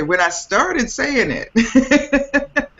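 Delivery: playful Southern drawl, high swoops with laughter; Southern accent. Pretend. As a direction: lay on a Southern US accent, stretched and amused. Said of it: "so there's my Southern accent".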